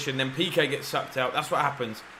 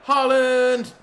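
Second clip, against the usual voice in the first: loud, high shout of the striker's name; goal yell; 11 semitones higher, 9 dB louder than usual. Real, not acted. Gamer roaring as his striker scores again, loud.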